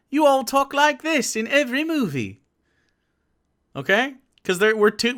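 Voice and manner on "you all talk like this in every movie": high-pitched mock British accent. Streamer teasing the British about their film voices, mock British accent. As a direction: lampoon the British with a put-on movie accent. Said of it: "we can't be fucked to do your real accent".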